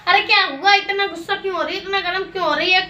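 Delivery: agitated questioning. Acted. Asking an angry partner what happened, agitated and insistent. Same question, livelier retake.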